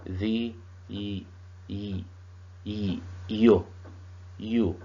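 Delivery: y, y, yo, you: the U in "usually" built up from a drawn-out y glide; sounding out the U. Shown as a demonstration. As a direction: slowly build "you" from its y sound. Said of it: "U sound like".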